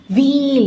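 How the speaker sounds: high, loud drawn-out shriek. A narrator's reading. Sangavai waking from a nightmare, shrieking. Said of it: "என அலறிக்கொண்டே".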